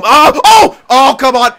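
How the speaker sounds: loud, high cries of dismay; exasperated outcry. Real, not acted. Player reacting to a missed chance, exasperated outcry. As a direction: cry out at a near miss.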